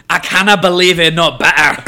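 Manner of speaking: loud, hammed-up fake Scottish brogue; bad mock-Scottish, played big for laughs; failed Scottish accent. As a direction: belt out a badly faked Scottish accent. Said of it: "for the failed attempt at the accent".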